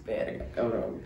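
hushed, slow, breathy curse; a frightened little whisper to yourself; scared whisper. Retold or imitated. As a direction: whisper it like a panicked kid. Kid's scared thought, retold.

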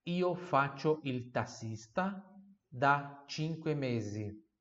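slow, split into two chunks; slow phrase-by-phrase model. Shown as a demonstration. Teacher chunking the sentence for students to repeat. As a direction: break it into parts slowly. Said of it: "Número três".

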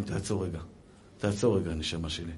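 low, soft, tender voice; gentle calming aside. Retold or imitated. Rabbi tells dear soul to stop, gently hushed. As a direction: quietly soothe them, dropping low.

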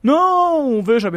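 high, loud drawn-out "não"; mock official fobbing someone off; retold evasive official. Retold official dodging the question.

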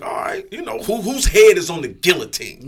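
loud retold trash talk; cocky challenge. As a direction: call out your next victim. Retold cocky challenge before battle.

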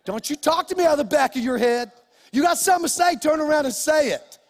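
loud, high, fast scolding; angry dad shout. Retold or imitated. Father yells at mumbling son, angry shout. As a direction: bark at the boy to turn around. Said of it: "I get mad then".

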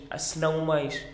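ordinary talk

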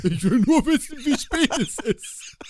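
high, slow, laughing imitation; hammed-up mock stalker; mock creep, laughing. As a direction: drag it out while giggling. Mocking friend, laughing.

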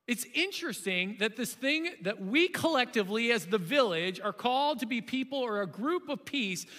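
usual speaking voice

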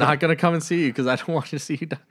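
laughing through a fast joking reply; half-laughing quip to the supervising pastor. Retold or imitated. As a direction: chuckle as you rush it out.

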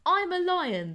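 high, loud indignant protest; loud complaint. A narrator's reading. Donkey to the half-blind goat, complaining loudly. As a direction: protest that you're a lion. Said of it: "the ass complained".

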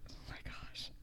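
breathy whispered disbelief; whispered oh my gosh. Real, not acted. Stunned her friend never heard slippy, whispering. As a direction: whisper it in shock.

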